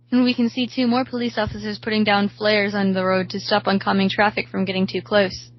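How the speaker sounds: ordinary delivery, nothing marked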